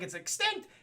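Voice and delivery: high, rising disbelief; incredulous question. Retold or imitated. Bruce hears the plant is gone, incredulous question. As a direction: echo it back as a startled question. Batman repeats the news in disbelief.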